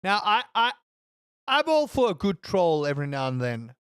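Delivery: ordinary talk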